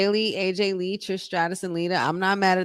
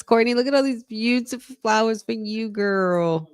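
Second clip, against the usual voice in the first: gushing; 5 semitones higher than usual. Real, not acted. Gushing over flowers.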